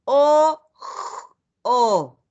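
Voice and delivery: "O, o" each vowel sound held alone; sounding out letters. Shown as a demonstration. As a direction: sound out "O" then "o" slowly. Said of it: "Luego separamos por letra". (separando el sonido "O, o")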